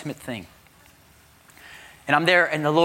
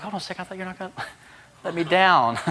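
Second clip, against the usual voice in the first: quick, laughing mock protest; mock indignant complaint; 5 semitones higher, faster than usual. Preacher playing a believer whose car broke down, mock indignant. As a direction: toss it off as a joke on God.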